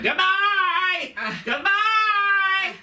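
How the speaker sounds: crazy clown call. Retold or imitated. Comic imitating his mother as a crazy clown calling goodbye. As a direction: sing it out like a mad funhouse clown. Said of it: "a crazy clown who would call out".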